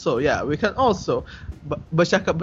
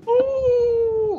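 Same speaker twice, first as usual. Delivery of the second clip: very high, drawn-out squealed hoot; high-pitched "ooh". Pretend. Reacting to a co-host after laughing, long squealed hoot.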